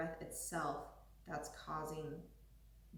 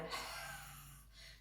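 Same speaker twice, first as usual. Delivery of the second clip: lion's breath exhale; 7 dB quieter than usual. Shown as a demonstration. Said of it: "And we're making a".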